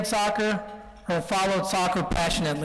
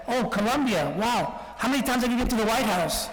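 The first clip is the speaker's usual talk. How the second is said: lightly voiced clueless admirer, pitched higher; mock amazement; 4 semitones higher than usual. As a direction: imitate someone naively impressed, lifting the pitch. Imitating clueless people's reaction.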